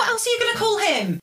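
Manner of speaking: high-pitched jokey indignation; playful protest. Real, not acted. Defending herself over saying his name, high playful protest.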